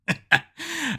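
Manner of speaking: hearty mock pirate laugh; a pirate's booming chuckle. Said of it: "pirate voice".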